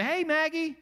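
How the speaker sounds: high, loud, sing-out call; acted cheery dog-calling; visitor calling a dog. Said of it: "you come to my house and you say".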